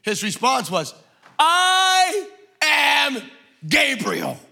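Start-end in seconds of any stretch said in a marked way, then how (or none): 1.4-4.4 shouted. Shown as a demonstration. Preacher showing Gabriel's reply, shouting. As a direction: bellow it like an offended angel. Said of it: "with an exclamation point".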